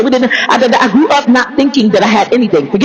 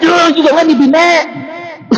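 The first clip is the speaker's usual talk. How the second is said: mock indignation; 5 semitones higher, slower than usual. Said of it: "I'm like".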